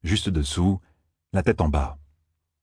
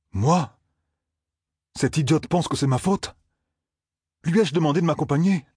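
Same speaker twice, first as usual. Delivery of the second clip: higher-pitched, mildly indignant questions; indignant Perrine; 8 semitones higher than usual. A narrator's reading. Blacksmith can't believe he is blamed, mildly indignant. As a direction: protest the blame with rising pitch.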